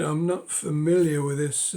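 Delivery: usual speaking voice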